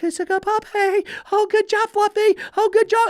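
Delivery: high sing-song "good job, Fluffy"; mocking cooing praise. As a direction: coo at Fluffy like a doting owner. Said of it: "So we're like".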